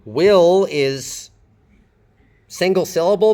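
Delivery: normal talking voice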